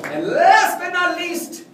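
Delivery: very loud, high, drawn-out announcer call; big announcement. Real, not acted. Lecturer introducing his final item, shouted announcement. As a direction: belt it out slowly to the crowd.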